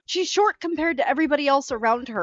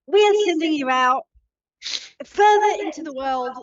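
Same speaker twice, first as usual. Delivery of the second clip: put-on Australian accent; 3 semitones higher than usual. The drow Jolene tells the party why she left home, in an Australian accent. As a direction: play her Aussie, casual and matey. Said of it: "'Cause the accent is flawless".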